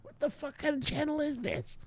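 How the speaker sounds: high, soft squeaky voice of a baffled viewer; squeaky mock viewer. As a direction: squeak it out like a whiny newcomer. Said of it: "you're like".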